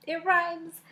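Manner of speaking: high amused exclamation; tickled surprise; mock delight. Real, not acted. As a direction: pounce on the rhyme with glee. Mocking the prose.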